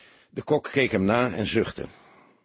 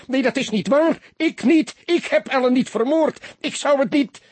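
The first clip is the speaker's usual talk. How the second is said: much higher, loud panicked scream; screaming denial; 6 dB louder than usual. A narrator's reading. Accused soldier screaming his innocence at the detective. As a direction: shriek it in terror. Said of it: "gilde hij".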